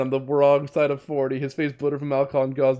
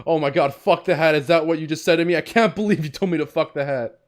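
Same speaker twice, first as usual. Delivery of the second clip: raised voice, outraged at being told off; loud indignation; 3 semitones higher, 4 dB louder than usual. Acted. Cop protesting to girl about the hat, loud indignation. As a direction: exclaim it in loud, acted disbelief. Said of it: "You're saying it really loud".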